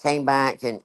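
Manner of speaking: ordinary talk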